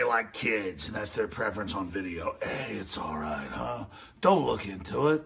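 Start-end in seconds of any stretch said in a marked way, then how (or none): 2.4-5.2 much lower and softer, sinister; low hushed sinister mock voice; creepy reassurance. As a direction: coo it like a creep. Voicing soothing pedophiles.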